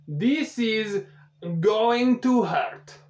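slow, high mock Russian accent. Russian specialist warns the players, in a slow hammed-up Russian accent. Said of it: "That's a Russian accent".

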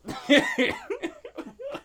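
squealing laugh; high-pitched laugh. Real, not acted. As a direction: shriek with laughter.

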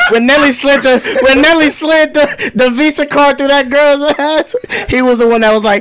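loud high excited retelling; excited telling. Real, not acted. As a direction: tell it loud and worked up.

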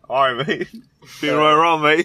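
loud British song-intro banter; hammed-up British "mate" talk; mock British accent. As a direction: imitate a British artist talking before the track. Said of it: "how people talk before their song starts".

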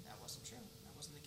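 soft whispered aside; whispering. Real, not acted. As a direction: drop to a whisper.

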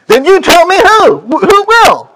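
high, pushy demand. Retold or imitated. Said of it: "she demanded".

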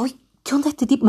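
hushed, scared whisper; whispering in fright. Retold or imitated. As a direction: breathe it out under your breath, spooked. Frightened thought about the stranger. (susurrando asustada)

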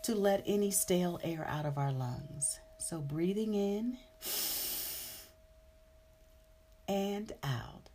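calm, even meditation guide voice; guided meditation. Acted. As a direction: lead the breathing gently. Guides breathing at meditation start.

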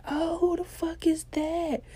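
high, drawn-out teasing call; nosy friends. Retold or imitated. Friends spot woman asking for number, high nosy outcry.

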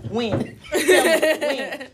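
demanding when through laughter; cracking up mid-demand; laughing demand. Retold or imitated. Retold confrontation with principal, laughing.

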